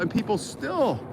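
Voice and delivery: normal talking voice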